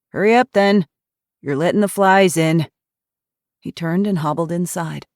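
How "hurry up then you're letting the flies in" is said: 1 gruff impatience. A narrator's reading. Grumpy old man rushing them in.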